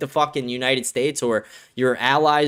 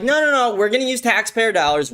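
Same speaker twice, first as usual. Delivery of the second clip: mock Trump protest; 8 semitones higher than usual. Ranter mocks Trump funding Gaza, high mocking protest. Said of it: "You're like".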